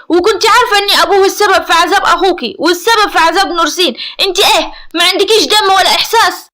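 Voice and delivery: high, loud, angry tirade; furious. A narrator's reading. Seif scolding his sister for shaming the family, furious. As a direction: lash out in a loud, relentless rage. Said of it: "بغضب شديد".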